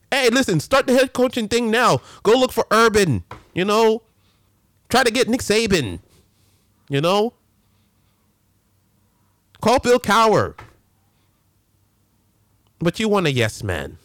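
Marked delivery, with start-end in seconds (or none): 0.1-10.6 urging. Acted.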